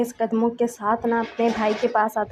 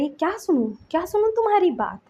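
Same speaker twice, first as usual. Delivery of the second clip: indignant refusal; 6 semitones higher than usual. A narrator's reading. Suyeng refuses Shinchan's explanation, indignant. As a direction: voice rising in exasperation. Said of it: "उसको गुस्सा भी आ रहा था".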